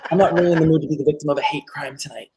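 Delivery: lightly voiced fussy disdain, dropping to a hushed hard pass; a touch of prissy refusal; David from Schitt's Creek. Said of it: "do David from Schitt's Creek".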